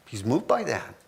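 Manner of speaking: ordinary talk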